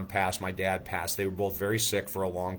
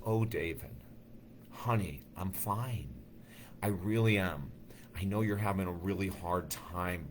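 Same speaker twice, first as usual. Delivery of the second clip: soft, tender voice of a mother comforting her son; softer, tender mother saying goodbye; loving mother; 6 dB quieter than usual. Retold or imitated. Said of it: "she was very loving".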